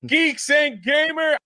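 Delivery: high, slow, stretched show name; silly announcer voice. Pretend. High slow put-on intro.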